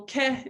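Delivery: ke, slow, with a vowel where the r should be. Shown as a demonstration. Therapist modelling a toddler's car without the r.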